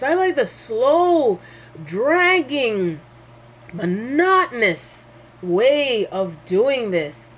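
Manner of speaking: slow, drawn-out, stretching every word; a mocking impression of a slow, bored talker; mock dragging drawl. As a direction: drag it out like someone savoring boredom. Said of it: "There's some of us that say".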